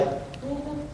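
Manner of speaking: high, soft, knowing mm-hmm; a pointed little hum; passive aggressive. Retold or imitated. Mom answering dad with a soft, passive aggressive hum. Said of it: "she's like".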